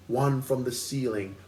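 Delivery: ordinary talk, nothing marked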